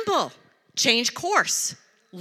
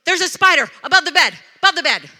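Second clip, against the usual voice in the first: imitated yelling; 3 semitones higher, 8 dB louder, faster than usual. Retelling pastor spotting a spider, mock panicked yell. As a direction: mimic a husband raising the alarm. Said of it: "he's yelling and he goes".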